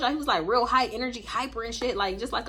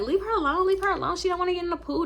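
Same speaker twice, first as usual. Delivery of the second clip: high-pitched mimicked defending; imitated friend's protest; 9 semitones higher than usual. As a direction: step in for her in a higher voice.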